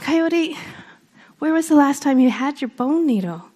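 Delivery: a hint of a wise owl probing gently. Owl questions Coyote about where he lost it, lightly voiced. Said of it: "And so Owl says".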